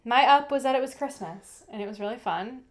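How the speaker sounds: normal talking voice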